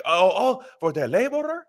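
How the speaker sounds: high, dawning surprise; mock realization. Union member realizing who's calling, high mock surprise. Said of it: "and you're like".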